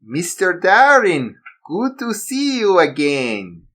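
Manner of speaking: put-on slow, high German-accented villain greeting. Villain greeting the assassin in German accent. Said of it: "He spoke in a German accent".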